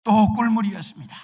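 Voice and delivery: very loud, high announcement; triumphant reveal. A narrator's reading. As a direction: burst out with the result after a long suspense. Reveals the final lucky outcome. (높고 아주 크게 환호하듯 발표)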